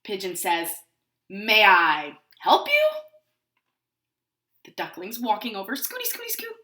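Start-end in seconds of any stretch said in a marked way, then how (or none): 1.3-2.9 slow, loud, pointed politeness; mildly irritated pigeon, drawn out; a little annoyed. A narrator's reading. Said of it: "A little annoyed?". 5.8-6.6 high, soft little patter; small high-pitched scurrying; tiny scooting noise. A narrator's reading. Voicing the duckling's little steps.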